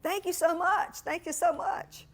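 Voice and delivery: high, gushing, slow thank-yous. Retold or imitated. Consultant gushes thanks to the donor, high and sweet. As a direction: gush with gratitude.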